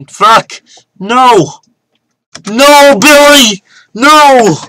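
high, loud desperate scream; panicked yelling. Real, not acted. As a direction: yell in real panic as you lose. Getting eaten in the game.

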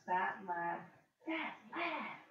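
excited taunting call, voice leaping up; with emphasis. Shown as a demonstration. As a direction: read it like an exclamation, with feeling. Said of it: "would probably be read like this".

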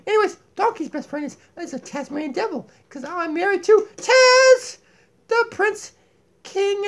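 high, lively puppet voice; Donkey puppet voice. Acted. Puppet Donkey lecturing viewers about cats, high puppet voice.

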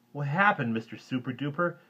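put-on high, loud child voice; little boy's question. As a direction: ask wide-eyed as a small boy. Little Louis questions hero.

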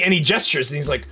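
ordinary delivery

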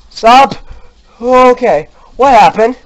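shout, then ask in shaken confusion; rattled after the scare; shaken alarm. Real, not acted.

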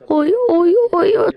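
high, loud panicked oy oy; panicking as the boy stands right beside them; alarmed cry. Acted. Panic waiting for bus.

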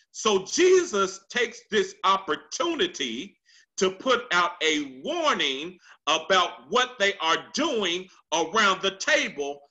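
ordinary talk, nothing marked

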